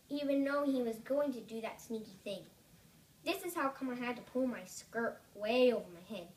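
usual speaking voice

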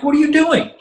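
high, loud, disbelieving cry of what are you doing; incredulous outburst. Retold or imitated. Bandmate catching friend stealing from a fan's house, incredulous outburst. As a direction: catch him in the act and can't believe it.

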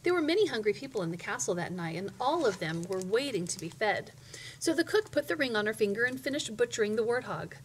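ordinary delivery, nothing marked

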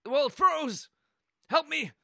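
high, loud cry for help; calling for help. Pretend. Player frozen in the game calls for help, high and loud. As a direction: call out high, in a fluster.

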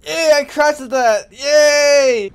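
high, loud sarcastic yay; mock cheering. Real, not acted. Mock celebration.

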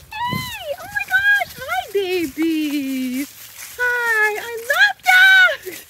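squealing high with delight. Real, not acted. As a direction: gush over the dog, very high. Excited greeting of a dog.

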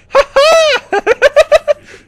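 loud, high shouted laugh; real victorious cackling, very high; gloating cackle. Real, not acted. Gamer gloating after a kill, wild loud cackle.

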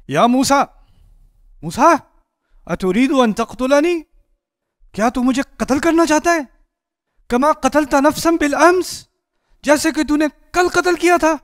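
high, loud desperate cry for help; calling out loudly. Retold or imitated. The beaten thief cries out to Moses in panic, high and loud. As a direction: shout it across the crowd, pleading and accusing. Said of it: "फ़ौरन आवाज़ पुकारता है, ऊंची आवाज़ में".

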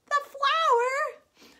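high, loud, drawn-out reveal; exaggerated storytime astonishment; mock surprise. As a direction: gasp it out high for the kids. Reveals what Fox is into.

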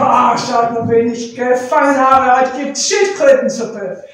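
loud, gloating announcement; triumphant boast. Acted. Leopard showing his village the catch, loud triumphant boast.

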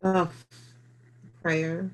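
ordinary talk, nothing marked

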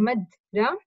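مدرسي with a break after "madra", then "sii"; مدرسي split to point out the i after س; kasra on the sīn. Shown as a demonstration. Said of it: "كسرة هيها على السين".

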